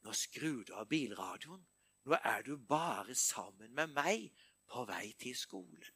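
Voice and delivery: low, soft voice of God; God quietly speaking to the preacher on his drive; God's voice, low and soft. Retold or imitated. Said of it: "så var det som Gud sa".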